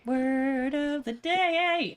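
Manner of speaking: drawn-out excited announcement; announcer shout. Acted. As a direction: call it out like a game show host. Announcing word of the day.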